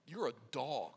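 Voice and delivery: low, soft and weighty; quiet, grave retelling of a hard word; grave hushed restatement. Retold or imitated. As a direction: let it land quietly, low in the voice. Restating a harsh saying softly for weight.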